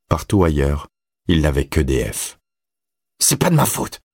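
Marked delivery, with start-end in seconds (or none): 3.2-4.0 high-pitched, spat-out denial; venomous boy, voice pitched high. A narrator's reading. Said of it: "cracha Todd, venimeux".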